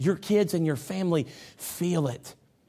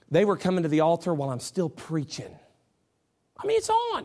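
ordinary talk, nothing marked

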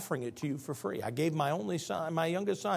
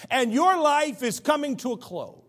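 ordinary delivery

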